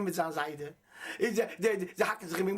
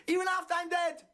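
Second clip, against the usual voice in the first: fervent shout; 11 semitones higher than usual. Real, not acted. Fervent climax about his legacy.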